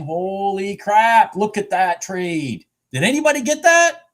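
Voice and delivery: loud, high burst of excitement; Holy crap, look at that trade, shouted high; excited shout. Real, not acted. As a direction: yell it out in real glee.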